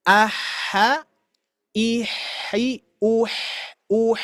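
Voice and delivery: uh, neutral h, softly. Shown as a demonstration. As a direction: reset the h before the vowel. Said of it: "perhatikan bibir saya di sini".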